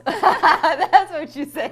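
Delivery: slow laughing tease. Real, not acted. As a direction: laugh it out slowly.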